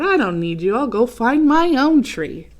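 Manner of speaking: mildly hurt, huffy ornament; huffy Otto. Acted. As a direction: storm off in a sulk.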